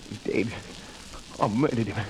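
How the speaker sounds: low, soft stunned dread; hushed horror. Acted. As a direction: whisper the horror to yourself. Realizing he killed Albert.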